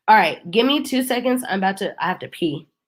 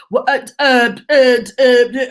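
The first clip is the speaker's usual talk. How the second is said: flustered uh uh, pushed a bit louder; mock stammering man; 4 semitones higher, 6 dB louder than usual. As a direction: stammer like he has no answer.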